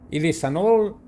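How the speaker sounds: usual speaking voice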